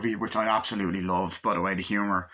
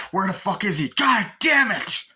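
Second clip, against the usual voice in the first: acted angry shouting; 9 semitones higher, 5 dB louder than usual. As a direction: yell it like a frustrated movie pursuer. Acting out the pursuers' angry shout.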